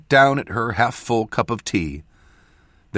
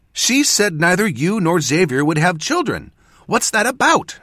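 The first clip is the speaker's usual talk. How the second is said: high, loud interrogation; insistent and indignant, climbing higher; demanding; 11 semitones higher, 6 dB louder than usual. A narrator's reading. Apprentice grilling mentor about children, demanding. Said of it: "she demanded".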